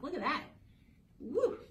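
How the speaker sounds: high, loud whoop; excited whoop. Real, not acted. Teacher whooping at a jumping grasshopper picture. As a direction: cheer with delight.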